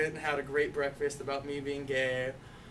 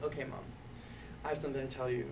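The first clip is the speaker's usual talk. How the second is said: softer, grave confession; serious; 6 dB quieter, much faster than usual. Retold or imitated. Son telling mom he has news, softer and serious. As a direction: drop the voice, serious now.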